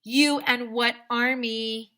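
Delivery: slow loud movie-hero dare; cocky dare. Shown as a demonstration. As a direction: sneer the challenge. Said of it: "you should always say".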